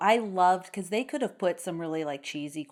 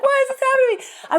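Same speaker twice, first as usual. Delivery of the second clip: much higher, louder, fast squeal of despair; panicked nanny; 7 dB louder, much faster than usual. Retold or imitated. Mimicking the crossbow nanny for friends, frantic squeal. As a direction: shriek it in a hammed-up frantic voice. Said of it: "She's like".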